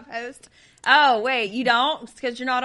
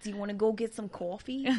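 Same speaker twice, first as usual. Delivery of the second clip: put-on light Brooklyn accent on coffee, said as a quick joke. Cohost jokingly tries a Brooklyn accent, light Brooklyn accent. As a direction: toss off a playful Brooklyn coffee line. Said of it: "all of my Brooklyn accent".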